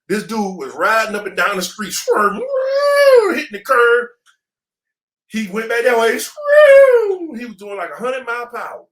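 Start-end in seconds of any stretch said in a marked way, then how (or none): 2.4-3.3 long, high, loud "woo" like screeching tires; imitated tire screech. Imitates a car swerving. 6.2-7.4 drawn-out high squeal of skidding tires; imitated swerve screech.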